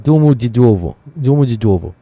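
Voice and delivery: Domodedovo said the Russian way, dumudidovo with o reduced to u; Russian accent. Shown as a demonstration. Pronouncing Domodedovo like a Russian to the stream: light Russian accent. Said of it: "with the Russian accent".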